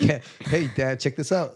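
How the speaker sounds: put-on high, loud cheerful kid voice calling Dad; mock baby announcing herself; playful baby voice. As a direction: voice a newborn showing off to Dad. Baby Mia voiced at birth.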